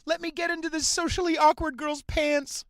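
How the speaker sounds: grovel in an exaggerated, high begging voice; mock begging. Scene: comic mimics himself begging a date for favors, mock begging. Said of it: "rather than just go".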